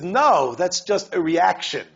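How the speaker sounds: loud, slow correction; contradicting voice. Retold or imitated. A rabbi answering a doubter, loud and slow. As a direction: set the record straight firmly. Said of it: "It says".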